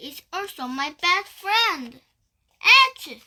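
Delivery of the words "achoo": high sudden achoo; a huge explosive sneeze; loud sneeze. Acted.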